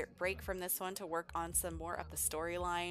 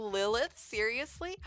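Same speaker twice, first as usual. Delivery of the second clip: thrilled, high squeal of surprise; excited disbelief; 5 semitones higher, 7 dB louder than usual. Acted. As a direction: gush with delighted disbelief. Layla thrilled about Damien's crush.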